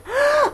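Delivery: high loud gasp of amazement. A narrator's reading. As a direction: gasp at the hatching egg. Egg starts hatching.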